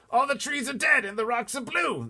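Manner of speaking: blustering officer exploding; shouting Air Force chief. Acted. Air Force head shouting at the pilot, outraged.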